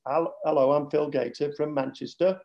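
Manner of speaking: Northern English accent. Shown as a demonstration. Dad showing his real Manchester accent, flat Northern English. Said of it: "my Northern accent".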